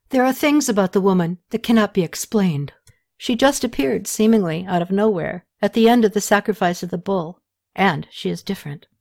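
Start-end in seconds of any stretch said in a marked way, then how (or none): none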